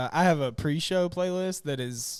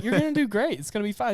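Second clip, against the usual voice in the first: reassuring; 6 semitones higher, faster than usual. Retold or imitated. Reassuring nervous friend before show.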